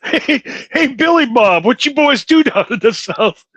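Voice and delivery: high, hammed-up Southern twang; Southern accent. Teasing question to Billy Bob about life down South, in a mock Southern accent. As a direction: holler like a cartoon Southerner.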